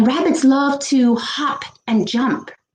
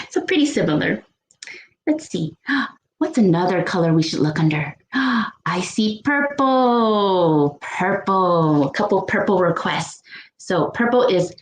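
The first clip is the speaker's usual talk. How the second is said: ordinary delivery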